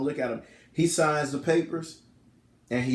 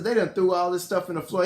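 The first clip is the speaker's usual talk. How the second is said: ordinary delivery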